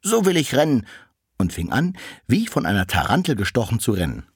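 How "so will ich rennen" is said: much higher, louder toy-like cart voice; the little cart asks the door, high and louder. Acted. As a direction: ask it brightly like a little wagon. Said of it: "Da sprach das Wägelchen".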